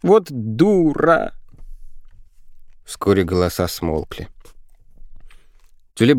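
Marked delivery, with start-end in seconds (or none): 0.0-1.3 high, long-drawn reproach; slow, high-pitched exasperated moan; lamenting. A narrator's reading. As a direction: wail the insult, stretching it out. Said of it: "причитала девочка".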